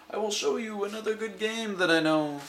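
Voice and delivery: playful cat. Acted.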